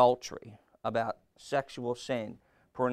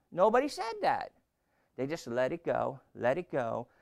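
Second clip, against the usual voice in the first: normal talking voice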